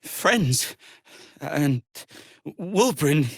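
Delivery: stammering, choked-up questions; trailing off into grief, voice catching; grieving disbelief. Acted. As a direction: reach for hope that isn't there. Grieving friend's reported death.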